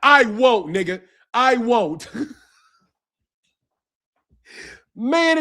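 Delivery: ordinary delivery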